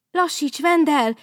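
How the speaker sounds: high, loud warning shout. Acted. Babóca warning speeding Vendel, loud high shout. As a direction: yell a warning across the ice. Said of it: "kiáltotta Babóca".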